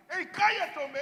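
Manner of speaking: loud, high shouted rebuke; reprendiendo. Acted. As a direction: shut him up like an annoyed crowd. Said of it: "lo reprendían". (regaño a gritos)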